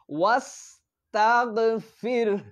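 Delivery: slow chanted ওয়াস্তাগফির; tajweed recitation. Shown as a demonstration. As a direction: recite ওয়াস্তাগফির slowly with a chest হা. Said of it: "সুন্দর করে উচ্চারণ করার চেষ্টা".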